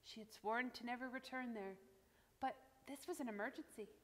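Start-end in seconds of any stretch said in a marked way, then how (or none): none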